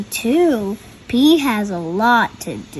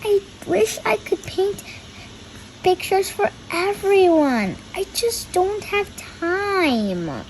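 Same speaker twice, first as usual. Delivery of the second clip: Pete; 7 semitones higher, 3 dB quieter than usual. A narrator's reading. Pete voiced, sad at mom.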